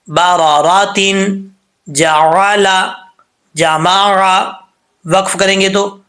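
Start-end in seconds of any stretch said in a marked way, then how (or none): 0.1-4.4 باراراتن, جعلا, جماعا drawn out slowly; slow recitation. Shown as a demonstration. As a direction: recite each word slowly with full vowels. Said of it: "اب اس کو sound میں پڑھتے ہیں۔".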